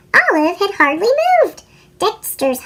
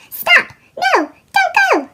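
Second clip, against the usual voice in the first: shouting; 13 semitones higher than usual. Acted. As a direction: yell to stop her in time. Squirrel warns Olive.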